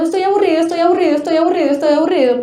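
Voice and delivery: put-on a bored child nagging the parent; whiny bored child. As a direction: whine estoy aburrido over and over like a fed-up kid. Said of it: "el niño está llorando, está diciendo".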